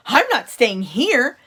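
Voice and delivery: scared in a huge shop, blurting it out; nervous Punchinello. A narrator's reading. Punchinello in the giant workshop, loud slow scared. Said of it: "he swallowed hard".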